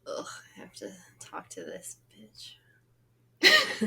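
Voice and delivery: slow, low, whispered mock dread; sarcastic reluctance. Co-host teasing host about talking to her, sarcastic. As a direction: groan it under the breath, very slowly. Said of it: "I was being sarcastic".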